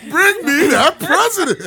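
high, slow, laughing plea; desperate begging. Pretend. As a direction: beg like he's starving.

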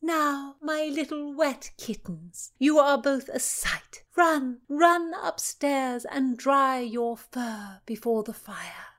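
high, slow, motherly noblewoman; fond lady's voice. Acted. Cecilia sending the soaked girls to the fire, high and fond. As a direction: coax them upstairs like a fond aunt.

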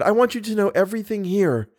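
urgent plea. A narrator's reading. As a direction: insist that he stay and listen. Christine pleads with Raoul to stay.

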